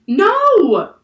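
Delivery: very high, very loud scream. A narrator's reading. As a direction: scream in horror. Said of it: "screamed Annie".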